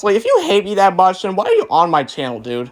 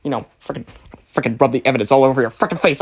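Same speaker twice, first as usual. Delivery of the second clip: ordinary talk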